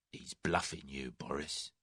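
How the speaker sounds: low, soft and unhurried; quietly cutting through the bluff. A narrator's reading. Shabby man warning Boris, very quiet. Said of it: "he said quietly".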